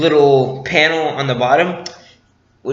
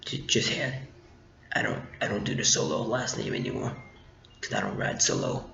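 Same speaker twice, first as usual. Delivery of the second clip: much lower and much softer, half-whispered; low hushed imitation of Han; 6 semitones lower, 6 dB quieter than usual. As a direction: play a cool loner shrugging off his past. Han explains his name.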